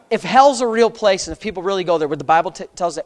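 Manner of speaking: ordinary delivery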